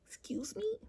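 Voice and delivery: high, whispered mock disbelief; mad disbelief. Woman replaying her reaction to his nonchalance, mad disbelief. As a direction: whisper it upward, scandalized. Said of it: "that was kinda making me mad".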